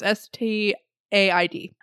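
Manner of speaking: slow, each letter of STAID said separately; spelled out letter by letter. Shown as a demonstration. Reading out a strange word by spelling it slowly. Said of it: "I think that's a word".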